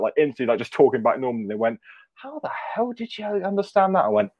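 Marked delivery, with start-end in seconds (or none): 2.1-4.1 high-pitched mock amazement; incredulous. Coworker amazed he understood the caller, incredulous. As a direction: imitate an astonished coworker, pitch jumping up.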